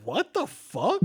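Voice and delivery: high, incredulous what the fuck; baffled as the car speeds away; stunned disbelief. Retold or imitated. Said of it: "I was like".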